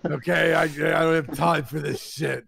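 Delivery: hammed-up impatient old woman; cranky old lady. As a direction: grumble as a busy, crotchety pensioner. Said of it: "She goes".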